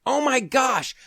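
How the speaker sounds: high-pitched burst of surprise; sudden realization. Real, not acted. Host remembering the biggest news at the end, sudden realization. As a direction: blurt it as it suddenly hits you.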